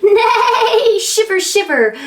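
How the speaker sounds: high neighing shiver; a cold horse whinnying. Acted. Voicing a cold horse for a child, high whinny.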